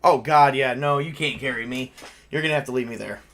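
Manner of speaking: ordinary delivery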